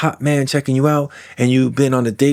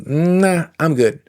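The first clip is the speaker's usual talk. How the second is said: a breezy "Nah" tossed up high, then a casual "I'm good"; mock casual turning someone away; dismissive brush-off; 6 semitones higher, much slower than usual. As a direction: wave them off lightly. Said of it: "not go".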